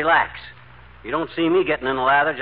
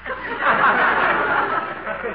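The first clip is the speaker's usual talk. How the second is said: big booming laugh; hearty laugh; 10 semitones higher than usual. Acted. [name] laughs at own joke.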